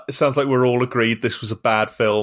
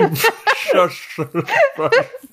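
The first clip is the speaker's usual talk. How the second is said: high giggle. Real, not acted. Laughing through accent attempt.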